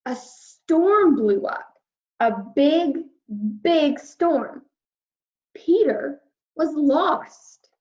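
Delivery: slow, rising storyteller voice for the storm; dramatic storm narration. A narrator's reading. Reading the storm scene to children, slow and dramatic. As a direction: build the drama as the storm hits.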